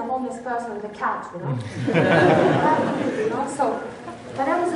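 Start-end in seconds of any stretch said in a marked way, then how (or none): 1.6-3.1 real loud burst of laughter. Real, not acted. Speaker amused by own cat remark, laughing loudly.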